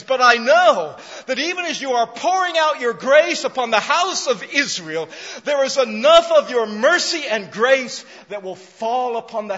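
loud, high, driving sermon climax; full-force preaching. Acted. Pastor proclaiming the woman's great faith, full-force preaching. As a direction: preach it hard and soaring.

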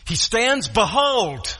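BEHOLD drawn out with a high, falling punch; emphatic announcement. Retold or imitated. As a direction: stand the word BEHOLD up like an exclamation mark. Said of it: "he wanted to make emphatically a point".

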